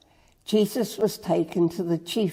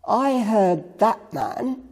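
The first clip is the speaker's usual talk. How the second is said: higher, slow, emphatic accusation; raised pitch, each word drawn out; announcing; 5 semitones higher, slower than usual. A narrator's reading. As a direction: announce it slowly as a public accusation. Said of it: "a man announced".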